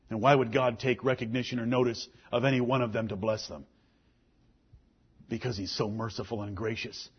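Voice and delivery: low, soft, tender preaching; hushed preaching. Acted. Preacher speaking of God's grace to the congregation, hushed and low. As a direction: draw them in quietly.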